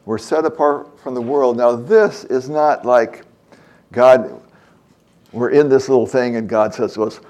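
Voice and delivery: ordinary delivery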